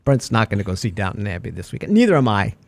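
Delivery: low mock voice ribbing a co-host; put-on low voice. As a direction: drop into a low, fast joke voice. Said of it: "I'm full of voices today".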